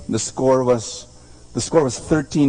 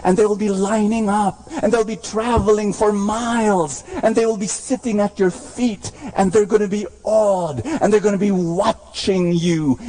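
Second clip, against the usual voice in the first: chanting, half-sung build; rising preached promise; 9 semitones higher than usual. Acted. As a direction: carry them up toward the promise.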